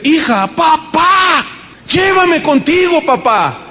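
high, slow cry of llévame contigo; cling to him, begging; desperate plea. Retold or imitated. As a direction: daughter begging her father in a vision, desperate plea. Daughter pleading in vision.

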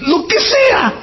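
high, wailing mock fan voice, near tears; gushing devotee. Preacher mocking a starstruck fan, high and wailing. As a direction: ham up a besotted follower crying over his idol.

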